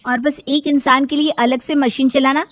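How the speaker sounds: lightly voiced, put-upon sister-in-law; defiant sister-in-law. Bhavna refuses to wash her brother-in-law's clothes, defiant.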